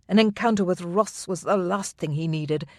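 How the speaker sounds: ordinary delivery, nothing marked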